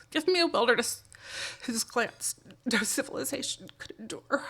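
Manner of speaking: choked up reading a quote; teary. Real, not acted. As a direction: voice thick with tears, fading soft. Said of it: "Sorry, Thoreau makes me teary".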